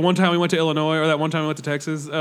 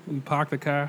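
lower, softer mock Boston accent; 5 semitones lower, 8 dB quieter, slower than usual. Wishing to be from Boston, trying the accent. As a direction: drop the Rs Boston style. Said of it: "from Boston 'cause they have a cool accent".

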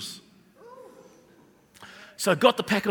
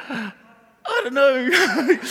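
put-on crying child; 4 semitones higher, much slower than usual. Hurt child answers grandmother.

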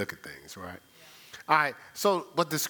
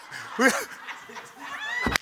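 very high cackling laugh; high burst of laughter. Real, not acted. Preacher laughing at brother's step, high laugh.

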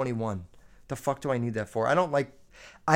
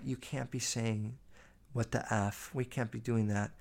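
much softer, prim imitation of mom; a hushed, prissy mock mom; mom scolding; 4 semitones lower, 10 dB quieter than usual. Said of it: "she was like".

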